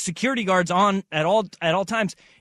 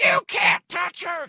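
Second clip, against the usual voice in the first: imitated screaming woman; 13 semitones higher, slower than usual.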